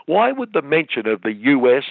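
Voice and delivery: ordinary talk, nothing marked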